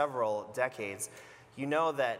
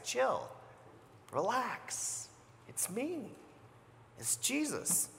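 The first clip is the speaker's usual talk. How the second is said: soft, higher, gentle reassurance; quiet soothing Jesus, raised pitch; calming Jesus; 9 semitones higher, 6 dB quieter, slower than usual. Acted. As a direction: hush scared friends gently.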